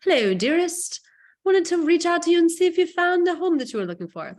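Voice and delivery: mocking the stiff email wording in a fake royal English accent; mock Queen of England. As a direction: ham up a stuffy royal letter-writer. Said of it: "Sounds like the Queen of England".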